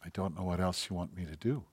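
soft, low, gentle answer; hushed wife's voice, not fighting back; quiet response. Retold or imitated. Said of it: "And she responds".

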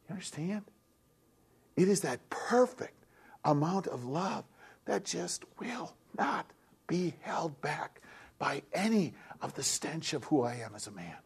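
breathy whispered sermon line. Real, not acted. Preacher whispering to the congregation about God's love. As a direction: drop into an intimate whisper.

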